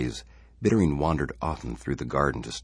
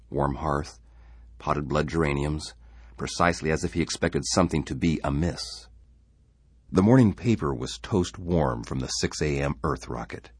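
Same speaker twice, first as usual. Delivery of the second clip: ordinary delivery, nothing marked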